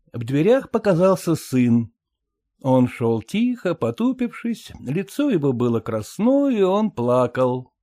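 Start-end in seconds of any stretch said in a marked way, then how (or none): none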